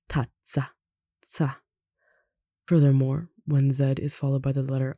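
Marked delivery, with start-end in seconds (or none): 0.1-1.6 "tazza" with a sharp tts in the middle, then "za" as tsa. Shown as a demonstration. Teacher demonstrates doubled Z, sharp TS sound. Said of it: "you pronounce it more sharply".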